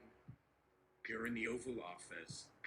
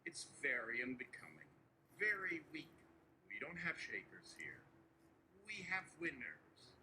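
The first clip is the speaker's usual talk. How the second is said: Donald Trump impression. Trump to nervous aide Barry, boastful and coaxing. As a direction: reassure Barry like Trump spinning a win.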